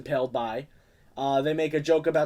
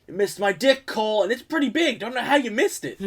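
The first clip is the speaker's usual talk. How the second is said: higher, louder cocky taunt; mock bully taunt; 8 semitones higher, 5 dB louder than usual. Bully Max taunting Cole after the missed punch, cocky and loud. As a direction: brag smugly at the kid. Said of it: "He misses, though".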